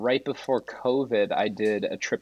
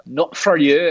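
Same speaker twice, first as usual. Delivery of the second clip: a snooty, louder "Not for you" with attitude; sassy put-down; 4 semitones higher, 6 dB louder, slower than usual. Retold or imitated. Waiter snubbing a drunk guest, sassy and dismissive. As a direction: look them up and down and dismiss them. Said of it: "it had the sassy eyes, like the look up and down".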